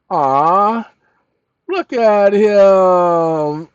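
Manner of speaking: long, slow, loud amazed cry. Real, not acted. Drawn-out amazed exclamation.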